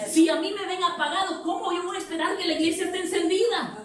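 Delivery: high-pitched, insistent sermon; impassioned preaching. Real, not acted. Preacher challenging the church by his own example, impassioned preaching.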